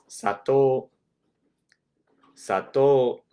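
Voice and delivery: sato, said with clear Japanese vowels. Shown as a demonstration. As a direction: model Sato slowly and clearly. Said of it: "The word for sugar in Japanese".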